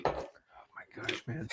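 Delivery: whispering. Real, not acted. Stunned reaction to accent bit.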